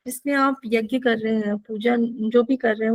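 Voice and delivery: usual speaking voice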